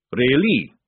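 loud, booming fat sergeant; hearty sergeant. Acted. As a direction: boom it like a jovial recruiter. Sergeant speaks.

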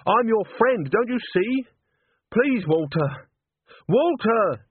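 beg, then call out for Walter; pleading then calling. A narrator's reading.

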